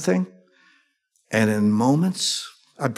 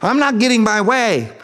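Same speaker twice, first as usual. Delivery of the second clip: mock whiny child; 7 semitones higher than usual. Preacher mocks a child's tantrum.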